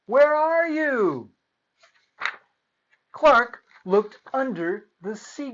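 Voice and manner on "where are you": high, loud worried call. A narrator's reading. Clark calls for his lost buddy, loud and high. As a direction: call into the crowd for a lost friend. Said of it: "said Clark".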